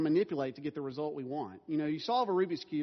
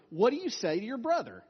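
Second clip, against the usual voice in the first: stern dad prompt; 6 semitones higher, 5 dB louder than usual. Retold or imitated. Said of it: "I will say to them".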